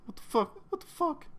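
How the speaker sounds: high, soft, near-crying whimper; breaking down in fear; tearful panic. Acted. Fred breaking down under torture, high tearful whimper. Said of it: "He starts, like, quietly crying".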